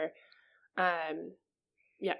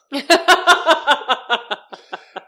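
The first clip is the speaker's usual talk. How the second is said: big loud laugh bursting out at her own joke; loud burst of laughter; 8 semitones higher, 13 dB louder than usual. Real, not acted. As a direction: laugh out loud, high and free.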